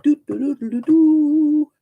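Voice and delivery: a playful brass fanfare sung on doo; mock trumpet fanfare. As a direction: toot your own horn like a trumpet. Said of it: "blow my own trumpet".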